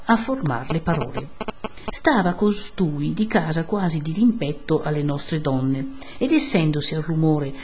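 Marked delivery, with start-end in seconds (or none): none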